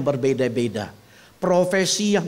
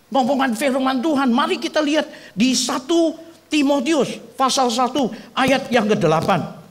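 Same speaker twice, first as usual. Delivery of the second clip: normal talking voice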